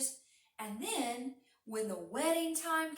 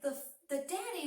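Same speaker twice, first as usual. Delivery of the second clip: ordinary delivery, nothing marked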